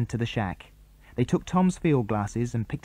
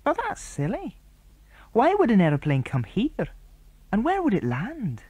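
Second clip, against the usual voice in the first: a schoolboy, puzzled and doubtful, pitched much higher; young lad frowning over a mystery, higher; boy character voice; 11 semitones higher than usual. Acted. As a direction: lift the voice high into a puzzled boy. Narrator voices Andy.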